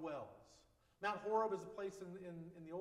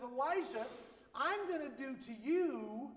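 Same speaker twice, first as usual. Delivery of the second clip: louder, higher, slow deliberate threat; queen's threat; 6 semitones higher, 7 dB louder, slower than usual. Acted. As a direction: deliver the warning slowly and forcefully, as a queen. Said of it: "this is the queen talking".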